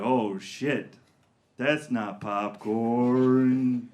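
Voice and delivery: low, soft, slow realization; dawning dread. Retold or imitated. Realizing what his finger found, quiet dread. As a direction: sink into horror quietly.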